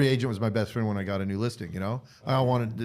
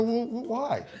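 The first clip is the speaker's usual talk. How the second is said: mildly baffled, pitched-up stammer; retold puzzled question; 9 semitones higher, slower than usual. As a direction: ask why, high and halting.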